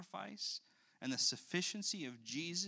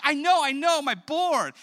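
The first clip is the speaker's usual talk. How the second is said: much higher and louder, squealing with pride; proud toddler; 12 semitones higher, 15 dB louder than usual. Retold or imitated. Father voicing his daughter bragging about her board. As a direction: squeal like a delighted little girl. Child answers the strangers.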